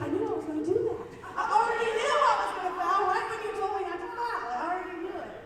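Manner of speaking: fast, high and loud blurt; excited admission. Retold or imitated. Player telling her coach she knew she'd foul, excited. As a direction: gleefully confess it. Player retorts after fouling.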